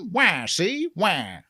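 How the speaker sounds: lightly voiced gangster baby; baby Edward G. Robinson. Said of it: "my Edward G. Robinson as a baby impression".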